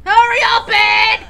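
shouting. Acted. Yelling at Ed in game.

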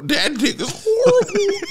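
high, slow, laughing through it; cracking up at his own line; giggled high and drawn out. Real, not acted. Repeats joke while cracking up.

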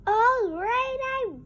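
loud, high shouted reply; loud, high-pitched shout. Acted. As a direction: yell it across the room. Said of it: "No need to shout".

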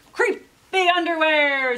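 loud, slow, thrilled cry; excited exclamation. A narrator's reading. Jasper spots the underwear at the store, loud and thrilled. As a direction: gasp it out like the best find ever.

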